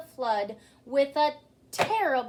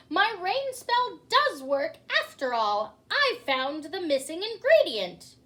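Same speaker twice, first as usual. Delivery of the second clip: loud, high gloating; wild angry magician; 6 semitones higher, 8 dB louder than usual. Acted. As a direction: crow over the storm in triumph.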